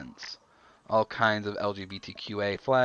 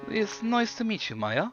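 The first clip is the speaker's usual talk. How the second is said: put-on higher-pitched German-accented greeting; 12 semitones higher than usual. A narrator's reading. Foreigner greeting Maya on first meeting, in a German accent. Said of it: "with an unmistakable German accent".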